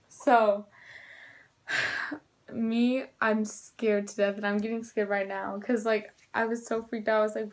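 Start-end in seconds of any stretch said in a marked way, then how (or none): none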